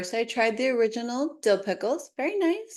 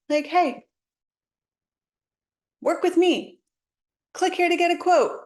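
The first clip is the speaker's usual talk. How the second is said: bright, hammed-up salesy voice, pitched higher and louder; pushy sales pitch; 7 semitones higher, 4 dB louder than usual. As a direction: play a cheesy marketer begging for clicks. Mock pushy marketer's sales pitch.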